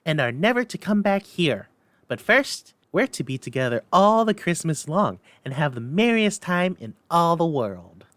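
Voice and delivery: put-on high, eager child's voice; little girl voice. A narrator's reading. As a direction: voice a delighted little sister. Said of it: "said the child".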